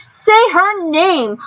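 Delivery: high, slow demand; demanding scream. A narrator's reading. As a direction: force him to say it. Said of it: "Rob screamed into the silence".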